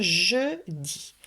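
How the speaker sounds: long JE, then DIS; "je dis" slowly, first syllable held; syllable stretched. Shown as a demonstration. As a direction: draw out "je" before adding "dis". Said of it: "on écrit la première syllabe".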